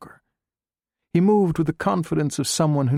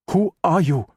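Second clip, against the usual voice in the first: higher, louder, pressing question; insistent repeat; 6 semitones higher, 3 dB louder than usual. A narrator's reading. Doctor Ma repeats his question to Chang, pressing and raised. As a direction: demand it the second time, harder. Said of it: "he repeated".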